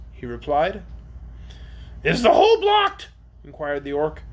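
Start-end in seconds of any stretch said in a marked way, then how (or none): 2.0-3.1 high, loud creature voice; inquiring orc. Acted. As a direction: ask sharply up high as an orc. Orc asks in figure voice.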